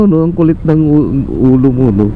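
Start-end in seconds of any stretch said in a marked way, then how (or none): none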